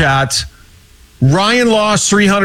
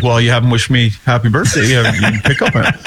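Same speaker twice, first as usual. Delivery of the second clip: lower, laughing mock pout; mock sulky friend; 7 semitones lower, faster than usual. Retelling co-host's sulk, laughing mock pout. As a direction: imitate the hurt birthday boy. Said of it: "he's like".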